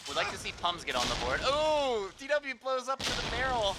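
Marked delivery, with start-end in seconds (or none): 1.4-3.8 high, loud shocked outburst; surprised. Real, not acted. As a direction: react like you can't believe it. Caster reacts to barrel explosion.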